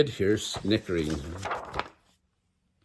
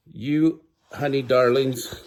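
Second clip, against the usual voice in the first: narration; 2 semitones higher than usual. Acted. Aunties cooing at the kids.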